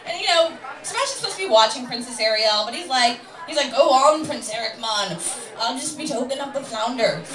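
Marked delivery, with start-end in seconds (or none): 3.7-7.2 put-on Sebastian-style crab with a poor Jamaican accent; badly faked Jamaican accent. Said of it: "That was the worst Jamaican accent ever".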